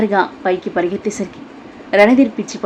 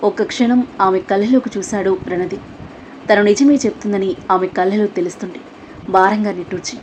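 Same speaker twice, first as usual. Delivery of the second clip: normal talking voice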